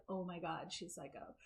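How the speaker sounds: hushed mock audience remark; mock gossip. Imitating the audience judging her, mock hushed. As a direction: lightly imitate a whispering crowd. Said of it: "everybody's like".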